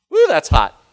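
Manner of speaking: loud whoop at the heat; hot potato yelp. Real, not acted. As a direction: shout it as fingers burn. Grabs another hot potato.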